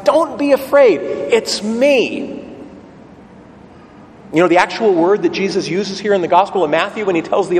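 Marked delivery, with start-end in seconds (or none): 0.0-2.4 calling out. Retold or imitated. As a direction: call across the water to calm them. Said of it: "Jesus cries out".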